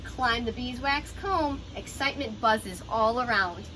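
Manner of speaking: ordinary talk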